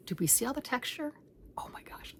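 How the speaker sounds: hushed, amazed whisper; awed whisper. Real, not acted. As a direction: whisper it as if in awe. Marveling at blanket texture.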